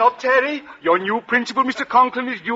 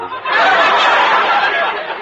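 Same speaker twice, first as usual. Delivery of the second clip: chuckle; 5 dB louder than usual. Real, not acted. Principal laughs at own quip.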